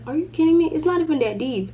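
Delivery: annoyed at the driver for flipping her off; retold indignation. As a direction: throw it back at the rude driver. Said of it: "I was so mad".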